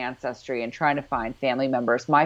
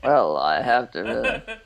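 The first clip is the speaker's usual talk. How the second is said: mock gruff reply; 5 semitones lower than usual. Voicing Mac's gruff reply.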